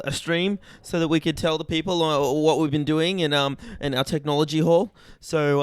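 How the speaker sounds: host it like a campus broadcast; mock announcer. Mock formal stream introduction.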